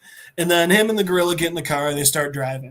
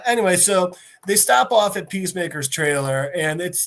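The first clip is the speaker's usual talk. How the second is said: usual speaking voice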